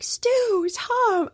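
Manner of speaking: high, loud call across a distance; joyful calling out. Retold or imitated. Stephen King spotting Stu and Tom, calling out loud and high. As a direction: shout the names up high like spotting old friends. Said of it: "Stephen King runs over and he's like".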